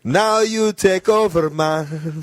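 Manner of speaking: slow, high hammed-up reggae crooner voice; playful reggae toast, teasing his friend into singing; mock reggae singer. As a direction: drawl it out like a laid-back reggae man.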